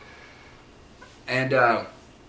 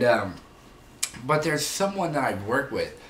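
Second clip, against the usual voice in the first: normal talking voice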